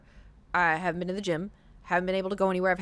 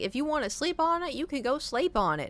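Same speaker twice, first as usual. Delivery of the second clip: put-on Texas accent; 6 semitones higher than usual. Mom's boyfriend letting her sleep on it, Texas accent. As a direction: play up the Texan twang, pitched up. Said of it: "very Texas".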